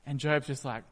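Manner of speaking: ordinary delivery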